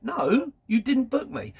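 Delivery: a driver refusing the job he was never booked for, exasperated; retold frustrated no. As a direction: snap back the refusal in the retelling. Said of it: "this is really frustrating".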